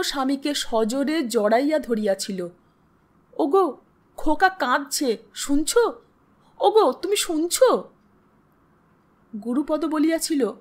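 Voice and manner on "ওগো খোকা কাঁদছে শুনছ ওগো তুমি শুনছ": frantic, raised-pitch plea; urgently calling for attention in alarm; panicked, agitated. Acted. Frightened wife rousing her husband about a crying baby, panicked. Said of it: "আতঙ্কে উত্তেজনায় দিশেহারার মতো".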